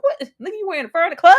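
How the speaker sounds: high, loud incredulous outburst; shocked disbelief. Retold or imitated. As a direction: call him out in total shock. Reacting to Ghostface's fur coat.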